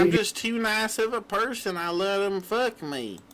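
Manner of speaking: high mocking woman voice; mocking falsetto. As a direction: mimic her in a high whiny voice. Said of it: "doing the cop's voice".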